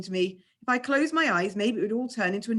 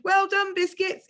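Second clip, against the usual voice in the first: high, loud shout into the wind; a cheering shout into the wind; 12 semitones higher, 4 dB louder, slower than usual. A narrator's reading. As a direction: call it out over the wind. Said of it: "but I shouted".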